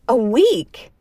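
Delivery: loud, high, incredulous question; loud outburst. A narrator's reading. Woman confronting the other over missed calls, loud outburst. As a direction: burst out in disbelief. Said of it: "the woman said loudly".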